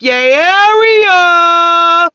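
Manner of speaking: "Yay, area" held very long, high and loud; drawn-out Yay Area call. Retold or imitated. Christ hyping up the Bay, high, loud and stretched. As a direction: sing out "Yay, area" like a hype shout-out. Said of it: "Teach, keep dropping them gems".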